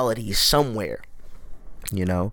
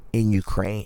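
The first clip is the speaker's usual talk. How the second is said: normal talking voice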